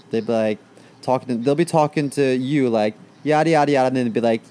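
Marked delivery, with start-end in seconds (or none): none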